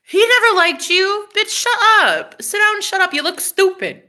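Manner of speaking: high-pitched imitation of naysayers; exaggerated sassy dismissal; mocking scoffers. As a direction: scold in a high mock put-down. Voicing people who scoffed.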